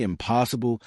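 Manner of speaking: ordinary delivery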